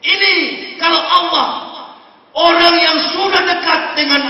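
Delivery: loud, high fiery sermon climax; preaching at full force. Acted. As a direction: drive it home to the crowd with rising fervour. (khotbah berapi-api dengan suara lantang)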